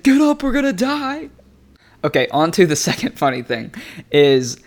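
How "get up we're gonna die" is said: high-pitched frantic wail; acted panic, pitch shooting up; crazy panic. Disciples shaking Jesus awake on the boat, frantic. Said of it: "crazy on the boat".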